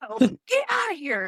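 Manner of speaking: mock yell. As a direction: imitate a doctor clearing the room, pitched high. Doctor clearing a loud room.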